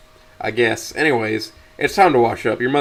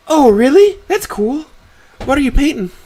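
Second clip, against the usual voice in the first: very high-pitched, excited mother voice; delighted mother; 7 dB louder than usual. Acted. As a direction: burst out with interest.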